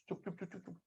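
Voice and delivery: breathy hushed chup, chup, no voice at all; shushing whisper. Real, not acted. Teacher shushing the online class in a whisper. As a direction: hush the class under your breath.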